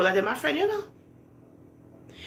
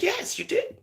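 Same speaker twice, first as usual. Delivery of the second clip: high quoted answer; 3 dB quieter than usual. Retold or imitated. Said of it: "she answered and she said".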